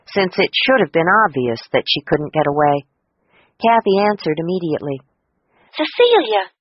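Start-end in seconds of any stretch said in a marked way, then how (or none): 5.7-6.5 high delighted call of her name; really pleased to hear from her; glad cry. A narrator's reading. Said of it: "she cried".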